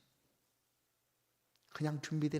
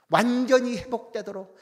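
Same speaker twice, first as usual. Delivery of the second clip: ordinary delivery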